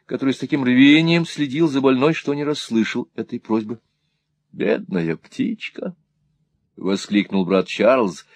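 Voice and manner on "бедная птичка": soft, low, slow murmur of pity; tender pity. A narrator's reading. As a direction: coo gently over her, hushed and slow. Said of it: "нежно взяв её за руку".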